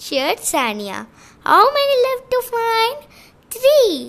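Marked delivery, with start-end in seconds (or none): 1.5-3.9 excited call. Acted. Counting game call and answer.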